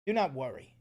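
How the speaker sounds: ordinary delivery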